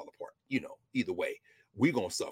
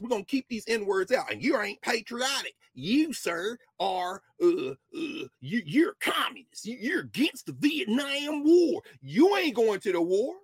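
mock segregationist; 4 semitones higher, slower than usual.

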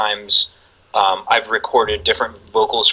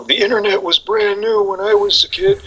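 1 mock old-timer; 10 semitones higher than usual. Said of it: "I'm sitting here saying".

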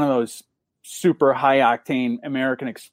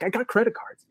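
high, softer rock star impersonation; squeezed-up, imitated star voice; 8 semitones higher, 6 dB quieter than usual. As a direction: mimic the rocker's protest in a raised pitch. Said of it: "he's doing the [name] thing".

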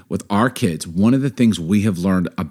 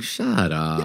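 low, soft, fond shut up; affectionate murmured brush-off; endearing; 6 semitones lower, 7 dB quieter than usual. Shown as a demonstration. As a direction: let it slide out gently and quietly. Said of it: "endearingly, can you do that?".

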